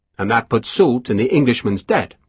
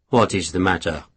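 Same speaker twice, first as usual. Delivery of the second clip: soft, lower, kind question; gently; 5 semitones lower, 3 dB quieter than usual. A narrator's reading. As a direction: ask gently, like comforting her. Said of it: "he asked gently".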